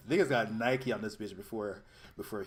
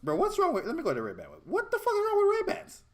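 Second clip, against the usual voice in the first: higher and louder, can't believe it; real indignant outburst over Ray-Bans; outraged disbelief; 6 dB louder than usual. Real, not acted. Arguing over sunglasses brands.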